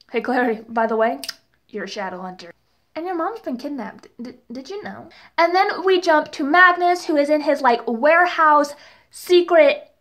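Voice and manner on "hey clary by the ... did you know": soft, sheepish aside; mock casual reveal. Mocking the show re-telling Clary her secret, quiet mock reveal. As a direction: drop your voice as if breaking old news.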